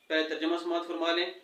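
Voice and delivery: usual speaking voice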